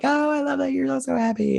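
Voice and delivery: giddy fangirl gush, high and breathless with delight; gushing squeal. Retold or imitated. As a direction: squeal it like a swooning fan. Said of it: "I'm all like".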